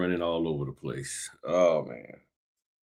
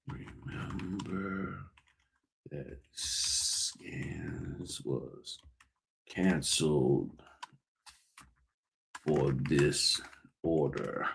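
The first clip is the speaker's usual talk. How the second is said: whispering; 2 semitones lower, 10 dB quieter, much slower than usual. Real, not acted. Reading screen to himself.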